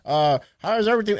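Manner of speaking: high, chummy phone greeting; overfriendly caller. Retold or imitated. Stranger calls the storyteller like a best friend, overfriendly, high voice. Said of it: "talking to me like he's my best friend".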